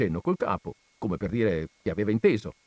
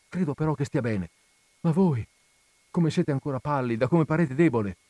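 high, heightened voiced dialogue; tender urgency between lovers; raised, eager reunion talk; emotional exchange; 4 semitones higher, 4 dB louder than usual.

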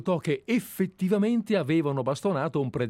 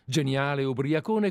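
normal talking voice

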